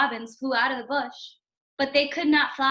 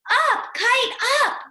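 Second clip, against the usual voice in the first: much higher, louder call skyward; shout; 10 semitones higher, 6 dB louder, slower than usual. Acted. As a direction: yell the kite up into the air. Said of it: "He shouted".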